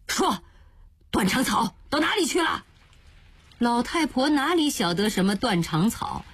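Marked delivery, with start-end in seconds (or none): 0.1-2.6 high, harsh demand; roaring demand. Acted. Jiaqiao grabs the old woman and roars at her. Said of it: "吼道".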